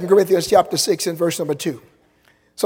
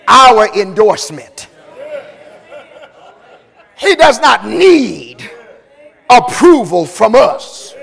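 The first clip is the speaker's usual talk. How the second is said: raised, slow, emphatic sermon voice; preaching at full force; 5 semitones higher, 8 dB louder, slower than usual. Acted. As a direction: press it home to the listeners. Preacher presses the point.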